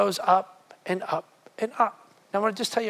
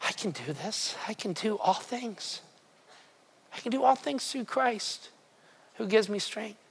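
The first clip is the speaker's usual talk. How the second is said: hushed quote; 7 dB quieter than usual. Retold or imitated. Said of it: "this little phrase hits this man".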